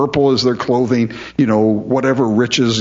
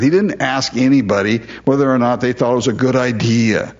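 ordinary talk

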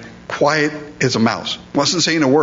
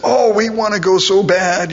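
insistent begging; 8 semitones higher than usual. Retold or imitated. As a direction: imitate kids pleading, pitched high. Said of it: "they were insistent".

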